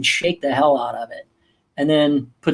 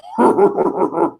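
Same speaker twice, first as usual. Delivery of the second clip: imitated cartoon villain laugh; put-on villain chuckle; Zurg laugh. Dad showing how a Toy Story villain laughs, villain laugh. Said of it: "Zurg goes".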